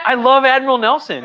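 high, loud gush of enthusiasm; excited. Real, not acted. Host gushes about Nelson to his laughing co-host, excitedly. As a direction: burst out with real delight. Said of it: "Who doesn't get revved up".

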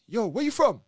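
loud, high call through the door; mock inmate calling through the cell door; inmate's call.

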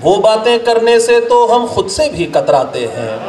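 measured, declaimed Urdu couplets with pauses; ghazal recitation. Acted. Poet presenting a ghazal to an appreciative gathering, declaimed recitation. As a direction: recite verse to a mushaira audience, line by line.

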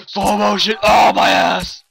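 loud pained shout. Real, not acted. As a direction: yell out mid fall. Ninja move fails, shouting.

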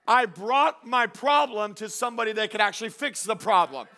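high, loud preacher's shout; impassioned, ringing sermon climax; full-force preaching. Real, not acted. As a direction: shout encouragement to the congregation.

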